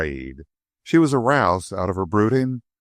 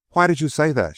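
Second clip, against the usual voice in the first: growing angry; 6 semitones higher than usual. A narrator's reading. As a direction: snap back at him, offended. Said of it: "growing angry".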